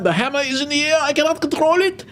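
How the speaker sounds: hammed-up helpless cry, climbing very high; mock alarm. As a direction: play at losing control, pitch rising and held. Auctioneer hams up the falling hammer.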